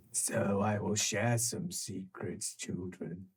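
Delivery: low, soft and sinister. Acted. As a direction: hush it down to a menacing murmur. Creature lowers voice.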